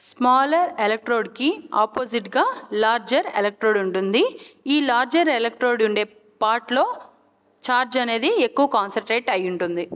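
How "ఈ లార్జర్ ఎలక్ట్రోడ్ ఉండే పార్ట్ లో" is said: usual speaking voice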